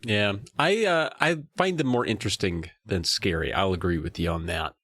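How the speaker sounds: normal talking voice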